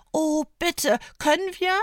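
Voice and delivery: viel höher, aufgeregt bitten; begeistert. A narrator's reading. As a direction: freudig betteln wie ein Kind. Fietes Gesicht hellt sich auf. (Fiete bittet begeistert um das Basteln)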